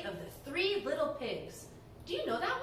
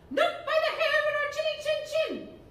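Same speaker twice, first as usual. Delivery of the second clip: much higher, louder laughing piggy voice; a tiny pig squealing back defiantly; giggly high pig refusing the wolf; little pig voice; 13 semitones higher, 6 dB louder than usual. Acted. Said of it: "playing the role of the pigs".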